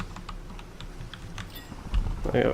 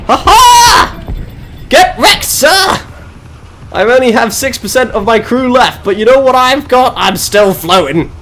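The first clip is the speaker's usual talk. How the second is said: much higher and louder victory yells; excited shout; 11 semitones higher, 5 dB louder than usual. Real, not acted. Streamer cheering his battered ship to chat, loud and excited. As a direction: cheer wildly at still being afloat. Cheering a near-loss in the game.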